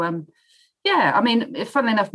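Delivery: ordinary delivery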